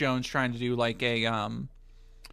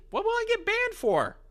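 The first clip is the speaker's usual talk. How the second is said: disbelieving squeak, far higher; incredulous squeak. Real, not acted. Chat warns of a ban, he squeaks in disbelief.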